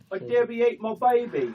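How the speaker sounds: high, mock Aussie quote; dingo-baby Aussie line; Australian accent. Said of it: "who can do the best Australian accent".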